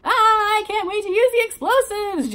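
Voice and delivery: high, loud squealing voice; squealed. Acted. June squeals to her bandmates, high and excited. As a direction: squeal it with giddy delight. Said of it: "June squealed".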